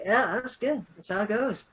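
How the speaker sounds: soft, unbothered aside; understated approval. Retold or imitated. Comics reader reacting to the silent theater, quiet and unbothered.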